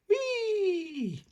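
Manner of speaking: very high sliding whee; high squeal of delight. Acted. Peppa squealing with joy going down the slide. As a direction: squeal as you whoosh down.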